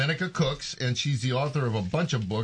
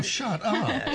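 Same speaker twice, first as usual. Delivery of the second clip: laughing; 6 semitones higher, slower than usual. Real, not acted. Playful teasing with laughter.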